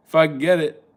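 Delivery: ordinary talk, nothing marked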